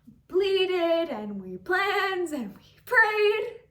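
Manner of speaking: PLEADED, PLANNED and PRAYED drawn out loud; impassioned listing. Acted. Daughter recalls the family's efforts, "pleaded", "planned", "prayed" each stretched and loud. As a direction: lean slowly, hard on "pleaded", "planned", "prayed". Desperate efforts to keep parents here.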